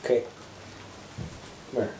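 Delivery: ordinary delivery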